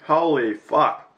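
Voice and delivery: drawn out loud swear; loud slow swear. Real, not acted. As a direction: stretch it out loud in shock. Shocked by sour candy.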